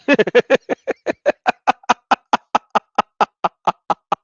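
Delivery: long open burst of laughter; real laugh. Real, not acted. Speaker to viewers, laughing at the verse. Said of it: "Okay, now I'm laughing".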